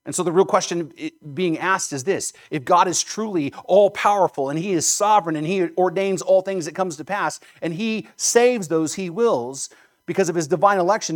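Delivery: ordinary talk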